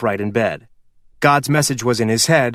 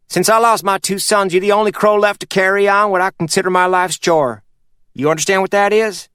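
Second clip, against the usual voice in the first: put-on old Kentucky patriarch, higher voice; a sly old mountain man giving orders; Purvis voiced; 8 semitones higher than usual. Purvis speaks of his legacy.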